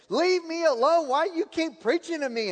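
hammed-up imitation of an irritated woman shouting; retold angry shout. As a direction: throw it back at the door-knocker in a mock yell. Lady slamming door, retold.

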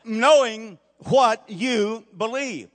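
slow, loud, every word weighed; emphatic theme. Acted. Preacher stating his theme to the congregation, slow and emphatic. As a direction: lay the theme down slowly and loud. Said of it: "sermon theme stated slowly".

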